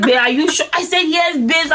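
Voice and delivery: ordinary talk, nothing marked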